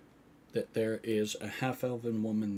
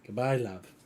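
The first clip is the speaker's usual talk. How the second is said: lightly voiced motherly healer; easy grown-up goodbye; healer's fond goodbye; 3 dB louder than usual. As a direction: answer a child's farewell fondly. Healer answers the girl's goodbye.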